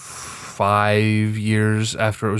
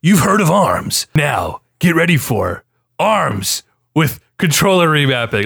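slow, hammed-up trailer voice; mock-epic promo, drawn-out words; melodramatic announcer; 4 semitones higher than usual. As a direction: announce it like an earth-shattering reveal. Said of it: "it was so melodramatic".

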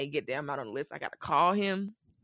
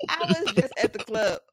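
loud, higher, laughing through the words; laughing admission; 4 semitones higher, 5 dB louder than usual. Real, not acted. Admitting a recent club night to her co-host, laughing. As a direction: confess it through real laughter.

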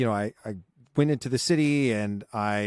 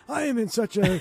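higher, hammed-up jingly excitement; mock gushing cheer; 10 semitones higher than usual. Co-host mocking his usual answer, higher gushing cheer. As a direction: play up the Christmas giddiness. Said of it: "I say".

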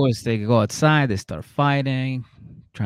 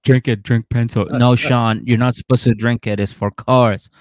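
loud, mock-pushy sales yell; mock shouting; 7 dB louder than usual. Hosts mocking Sean's oil sponsorship, loud mock yelling. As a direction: shout the pitch like a hammy pitchman.

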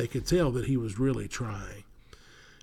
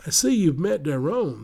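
higher, louder friendly elf king; christmas elf; 5 semitones higher, 5 dB louder than usual. A narrator's reading. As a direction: welcome them as the kindly elf. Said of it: "the tall elf continued".

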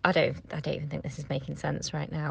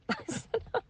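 higher, laughing through it; 11 semitones higher, 4 dB quieter than usual. Real, not acted. Mother repeating her plea to the universe, laughing. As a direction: laugh it off after tears.

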